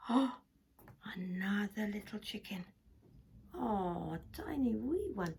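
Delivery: usual speaking voice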